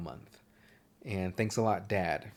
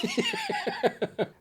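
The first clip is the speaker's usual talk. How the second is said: squealing laugh. Real, not acted. Laughing at own accent.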